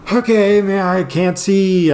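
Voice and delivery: high, drawn-out mock alarm; acted panic. Man to himself, lost in the dark, mock panicked. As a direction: stretch it out in rising comic worry. Acting out panicked thoughts in darkness.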